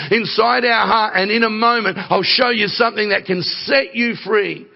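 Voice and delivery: high, urgent preacher's run; impassioned preaching. Real, not acted. Preaches with rising force.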